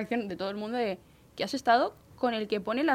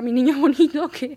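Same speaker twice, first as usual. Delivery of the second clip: laughing, louder echo of an endearment; amused echo; 4 semitones higher, 7 dB louder than usual. Retold or imitated. Employee echoes the boss's pet name, amused. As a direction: repeat it with a laugh breaking in.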